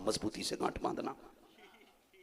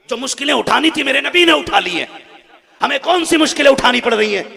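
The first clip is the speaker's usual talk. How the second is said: high, loud declamatory shouting; full-force preaching; 11 semitones higher, 19 dB louder than usual. Acted. Preacher telling the crowd of Khadija's sacrifice, impassioned shouting. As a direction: belt out the sacred story to the crowd.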